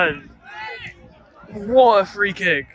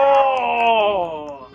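excited shout; 6 semitones higher than usual. Real, not acted. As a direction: yell out as the ball flies in. Big chance at the match.

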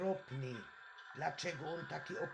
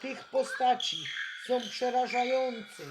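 frightened shouting; 5 semitones higher, 6 dB louder than usual. Real, not acted. Game monsters jump out at night.

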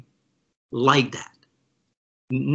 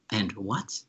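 unimpressed; 7 semitones lower, 10 dB quieter than usual. Retold or imitated. Jim's indifferent reply, retold.

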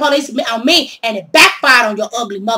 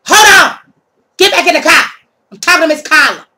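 higher, louder stern order; police command; 5 semitones higher, 6 dB louder than usual. Retold or imitated. Officer cutting off the thief to talk to Carla, loud stern order. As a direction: stop her with a stern order.

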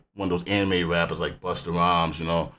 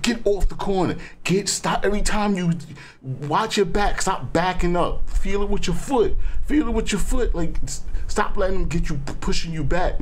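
high-pitched, frustrated cornerman pleading; worked-up fight fan yelling advice; urgent coaching; 10 semitones higher than usual. Retold or imitated. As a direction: urge the fighter on in a high, pressing voice. Reliving his yelling at fighter.

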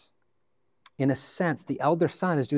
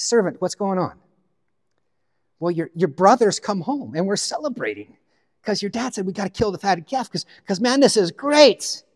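excited servant; 7 semitones higher, 5 dB louder than usual. Acted.

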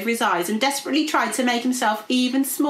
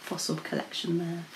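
usual speaking voice

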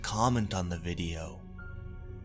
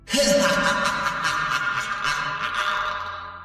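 breathy, high, far-off laughter; a spirit's triumphant laugh; ghostly laugh; 9 semitones higher than usual. Acted. Pharaoh's final laugh.

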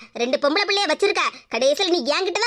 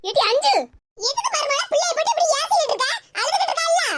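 higher, scolding elder; old mother-in-law; 6 semitones higher than usual. Acted. The grandmother scolds her daughter-in-law for making the girl cry.

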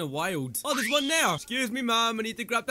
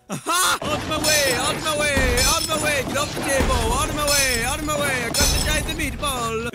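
loud, higher repeated calls; excited shouting; 4 semitones higher, 7 dB louder than usual. Pretend. Gamer carrying the giant meatball through, excited shouting. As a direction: holler to clear everyone away.

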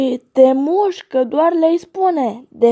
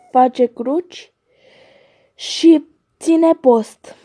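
usual speaking voice

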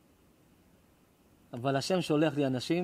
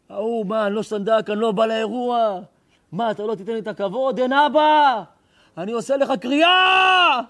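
mock outraged shout; 8 semitones higher, 10 dB louder than usual. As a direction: rail at God like an offended father left out of the party. Complaining loudly to God. (צעקה מחאה מול הקב"ה שהולכת ומתגברת)

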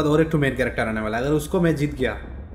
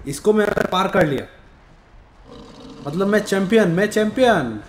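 excited; 6 semitones higher than usual. Real, not acted. Gamer boasting about being champion.

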